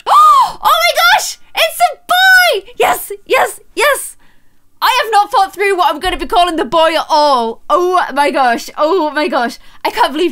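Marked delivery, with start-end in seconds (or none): 0.0-4.2 high, loud, drawn-out squeal of joy; excited shout. Real, not acted. Streamer shrieking to viewers that the baby is a boy, overjoyed. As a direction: shriek the news out slowly.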